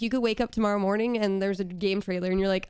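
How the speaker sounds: usual speaking voice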